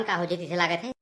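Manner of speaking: normal talking voice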